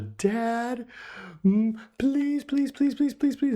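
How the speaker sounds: high-pitched imitation of a pleading daughter; begging child. Daughter begging dad over the phone, high imitated plea. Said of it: "they're just begging for".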